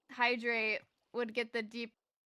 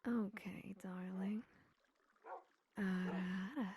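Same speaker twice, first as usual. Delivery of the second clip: deeper, breathy character voice; Zora voice; 3 semitones lower, 5 dB quieter, slower than usual. Shown as a demonstration. Streamer demonstrates the Zora voice, deep and breathy. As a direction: slip into a hushed, low-pitched drawn-out purr. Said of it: "going deeper, I go".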